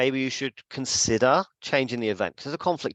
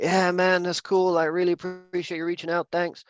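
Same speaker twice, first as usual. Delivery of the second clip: higher, easygoing friendly voice; Devon impression; 7 semitones higher than usual. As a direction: sound relaxed and grateful. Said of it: "I'm gonna do an impression of you".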